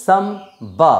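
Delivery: সাম বা with a pause between syllables, b sounded; syllable split. Shown as a demonstration. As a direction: separate সাম from বা, starting বা on an audible b. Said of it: "তখন কিন্তু তার pronunciation হবে".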